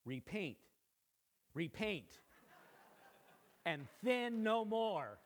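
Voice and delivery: slow, booming voice from the sky; lightly voiced God from the thunder; mighty voice. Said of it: "a mighty voice spoke".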